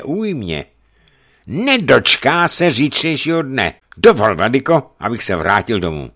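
loud retort rising in pitch; threaten, then ask to leave; agitated boyar. Acted.

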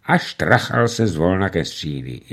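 ordinary delivery, nothing marked

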